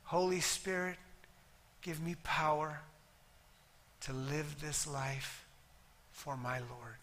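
soft, slow prayer voice; hushed prayer; quiet prayer. Acted. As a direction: pray gently with the congregation. Leads salvation prayer.

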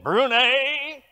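a hint of the best man's teasing scold; Jules's voice. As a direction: mimic your old friend scolding you, lightly voiced. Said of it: "To which my best buddy said".

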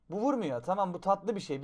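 ordinary delivery

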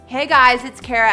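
ordinary talk

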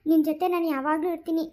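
usual speaking voice

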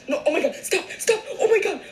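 loud, high, laughing panic; panicked shrieks. Real, not acted. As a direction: fend off the charging dog. Dog charging at her.

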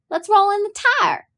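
put-on a young girl's high bright suggestion, light and quick; eager suggestion. A narrator's reading. Girl proposing a game to her brother, eager and high. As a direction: pitch it up like a kid with an idea. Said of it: "I suggested".

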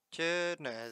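usual speaking voice

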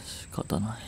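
whispered sigh of giving up; resigned whisper. Acted. Caught out with the bag, he gives up in a soft whisper. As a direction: whisper it, defeated.

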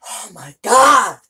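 drawn-out loud anguished "oh my god"; exasperated cry. Real, not acted. Player shot again, crying out at the game.

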